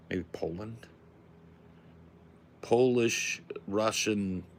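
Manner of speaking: put-on broken-English Russian accent, lightly done; mild mock Russian accent with dropped articles. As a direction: play a Russian pitching a movie plot, in accent. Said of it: "Is my Russian accent so good".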